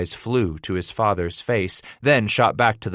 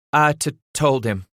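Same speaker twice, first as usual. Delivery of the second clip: higher, timid stammer on "told"; frightened, pale voice; 6 semitones higher than usual. A narrator's reading. Son confessing to angry father, frightened stammer. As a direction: confess haltingly, afraid of the answer. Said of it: "In a frightened, pale voice".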